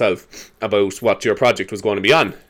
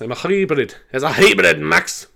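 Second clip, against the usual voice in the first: put-on higher, playful Scottish accent; mock Scottish, pitched up; 6 semitones higher, slower than usual. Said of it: "they sound great in a Scottish accent".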